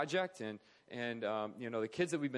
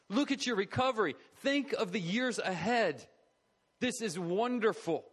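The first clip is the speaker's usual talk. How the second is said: celebrating; 5 semitones higher than usual. Acted. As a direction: celebrate a patient's recovery, stretching the words. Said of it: "he celebrates".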